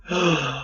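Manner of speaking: sharp intake of breath; gasp. Real, not acted. Listener reacting as the story heats up, gasping. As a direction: gasp at a juicy reveal.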